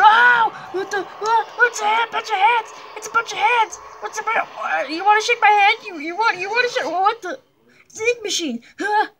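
high loud bewildered exclamations; shocked and baffled. Real, not acted. Player confronted by strange hats, high baffled shouts. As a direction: blurt in disbelief. Surprised by a hat-bunch and hand.

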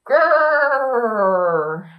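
mock tiger growl, rising at the end; playful growl. Showing children what a tiger sounds like, playful mock growl. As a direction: growl like a small cat pretending to be big.

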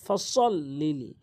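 slow ফসল্লিলি with an emphatic s; ফসল্লিলি recited slowly with Arabic sad; heavy sad. Shown as a demonstration. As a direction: stretch ফসল্লিলি, keeping the s heavy, never th. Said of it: "সতের উচ্চারণ করবেন".

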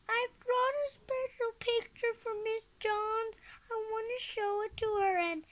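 put-on high-pitched child's whine; whining. A narrator's reading. Ross wants to show Miss Johns the picture, whining. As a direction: plead like an upset little kid. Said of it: "Your whining is hurting my ears".

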